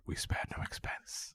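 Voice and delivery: slow whispered boast; whispered quote. Retold or imitated. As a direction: whisper it slowly like a proud secret. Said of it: "Amber would be like".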